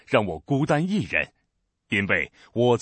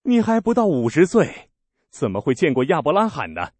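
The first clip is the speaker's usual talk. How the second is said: starting much higher and louder, then settling; scoffing disbelief; 5 semitones higher, 5 dB louder than usual. Acted. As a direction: ridicule his claim. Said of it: "犹太人说".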